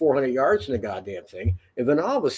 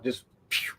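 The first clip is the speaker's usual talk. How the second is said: whooshing pew sound; 3 semitones lower, 9 dB quieter than usual. Retold or imitated. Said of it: "sound effect of vanishing".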